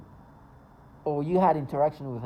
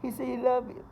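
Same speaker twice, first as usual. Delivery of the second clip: high, put-on weepy voice; pretend crying; 8 semitones higher than usual. Accusers backing up their story, pretend crying. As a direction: imitate someone faking tears. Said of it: "pretending, like, crying, making themselves cry".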